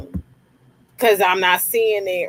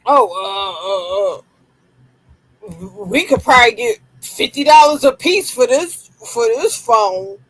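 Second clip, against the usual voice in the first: fast, stammering mock-thief voice; mocking dumb thieves; 2 semitones higher than usual. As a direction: play a greedy dim thief. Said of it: "they up here probably like".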